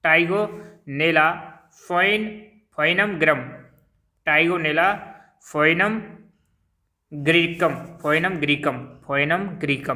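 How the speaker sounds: "Tigonella foinum greecum" said slowly, word by word with pauses; slow dictation. Shown as a demonstration. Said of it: "दोबारा मैं बोल रहा हूँ इसको".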